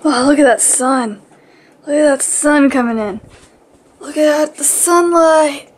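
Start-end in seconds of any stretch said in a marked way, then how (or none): none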